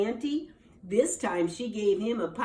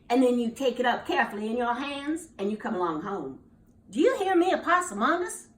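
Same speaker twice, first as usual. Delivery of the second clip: scolding mama; 3 semitones higher, 4 dB louder than usual. Acted. Mama scolds the foolish son.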